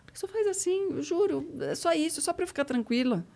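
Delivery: high, soft pleading; retold coaxing. Journalist begging the guest to reassure her, soft high coaxing.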